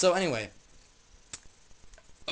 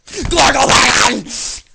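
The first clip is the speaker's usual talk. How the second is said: very loud, high, slow bellow; drawn-out nonsense yell; 9 semitones higher, 14 dB louder, much slower than usual. Acted. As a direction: stretch the word into a huge yell. Other dude stares then yells.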